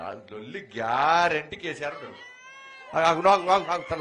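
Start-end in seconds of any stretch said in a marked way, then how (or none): 3.0-4.0 loudly calling out for the crowd to wait; loud calls to wait. Real, not acted. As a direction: raise the voice to halt the crowd. Stopping a noisy crowd. (గట్టిగా ఆగమని పిలుపు)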